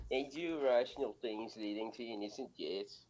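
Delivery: scuffed, drugged-out Aussie accent, cracking up; Australian accent. Shown as a demonstration. Co-host imitating a student's paper for laughs, crazy Australian accent. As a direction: read it in a badly faked Australian accent. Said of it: "drugged out accent".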